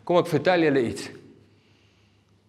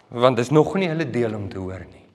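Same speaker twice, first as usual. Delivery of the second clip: ordinary talk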